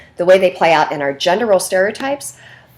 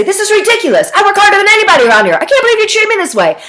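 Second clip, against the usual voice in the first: high, loud, fast indignant shouting; angry rant; 12 semitones higher, 6 dB louder, faster than usual. Shown as a demonstration. Fired man yelling at the boss in an angry rant. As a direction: explode at the boss, rattling it off. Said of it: "And I get angry".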